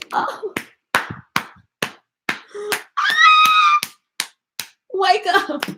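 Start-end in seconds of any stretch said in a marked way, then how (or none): 2.5-3.9 sudden high yelp from a dead leg; real pained yelp. Real, not acted. Streamer to viewers, real high pained yelp. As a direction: cry out as pins and needles hit. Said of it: "I can't even talk".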